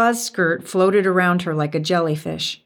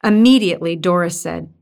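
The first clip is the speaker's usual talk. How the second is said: ordinary delivery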